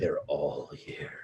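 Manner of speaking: eerie whisper. Pretend. Player joining the crowd's worry about demons, eerie whisper. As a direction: whisper it slowly, like a creepy warning.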